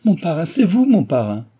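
high, loud cry of recognition; joyful cry. A narrator's reading. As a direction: burst out as the truth hits you. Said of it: "elle s'écria".

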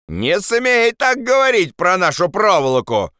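high-pitched indignant rebuke; shouting. Acted. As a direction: call down a sharp warning. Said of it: "крикнул кто-то". (возмущённый окрик)

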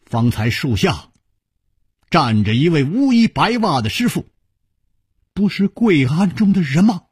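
halting, then climbing high in disbelief; stunned, faltering question. A narrator's reading. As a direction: ask it bewildered, pitch rising toward the end. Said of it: "他讷讷地说".

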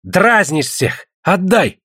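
high, slow reproach ending sharply; exasperated high-pitched insistence; indignant demand. Acted. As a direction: demand it like a teased suitor. Thwarted suitor demands the girl.

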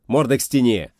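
loud, harsh command; barked order. A narrator's reading. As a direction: bark it at the rioters. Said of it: "рявкнул Каперанг".

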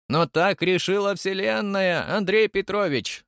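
high, slow, stern pronouncement. A narrator's reading. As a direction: lay down the Universe's verdict strictly. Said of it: "строго вымолвила Ариадна Владимировна". (строго, высоко и размеренно)

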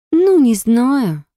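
slow drawn-out doubtful ну, не знаю; drawled. Acted. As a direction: drag out ну, не знаю skeptically. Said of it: "протянула Снежана".

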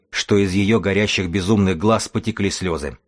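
ordinary delivery

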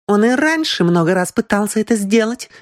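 high-pitched woman's interjection; accusing woman. A narrator's reading. Аврора adds to the charges against Хэй, insistent. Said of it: "быстро добавила Аврора".

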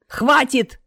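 loud, sharp "enough!"; short, snapping command; barked order. A narrator's reading. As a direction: bark it to cut them off. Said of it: "рявкнула Смерть".

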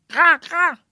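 high, loud crow cry; crow caw. Acted. The bird cawing as it flies up, loud caw. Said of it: "zegt de vogel".

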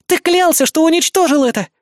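высоко и громко, с негодованием; возмущённо обвинить отца. A narrator's reading. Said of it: "возмутилась Критика". (дочь возмущённо кричит на отца)

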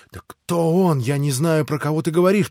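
scared, trying to calm him; anxious high protest; frightened. A narrator's reading. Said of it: "уже в испуге".

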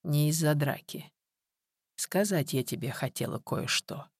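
soft, slowed, weary delivery; grave, quiet. A narrator's reading. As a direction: keep it hushed and measured. Aunt gravely confronting Viktor.

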